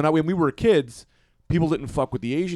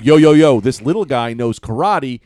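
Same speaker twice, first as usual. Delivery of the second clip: hyped up; 9 dB louder, slower than usual. Retold or imitated. Hyping up a kid fighter.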